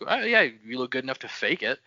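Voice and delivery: ordinary talk, nothing marked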